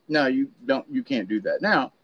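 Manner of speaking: usual speaking voice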